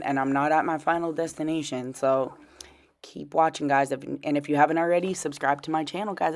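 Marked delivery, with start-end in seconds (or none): none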